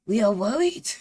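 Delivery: loud, high hammed-up French detective; exaggerated comic French accent; mock French accent. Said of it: "I wasn't expecting all the accents".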